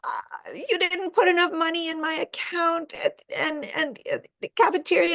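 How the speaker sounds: high panicky complaining daughter on the phone; whiny overwhelmed daughter. Acted. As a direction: play a helpless freshman complaining to mom. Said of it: "she sounds like this".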